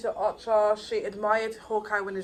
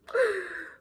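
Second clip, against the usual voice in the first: sharp, high excited gasp; fangirl gasp; 10 semitones higher than usual. Real, not acted. Fan imagining meeting Hawkeye, high gasp.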